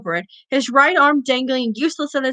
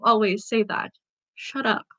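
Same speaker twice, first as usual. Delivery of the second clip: annoyed aside; 6 dB quieter than usual. Real, not acted. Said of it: "Kotsky is being annoying".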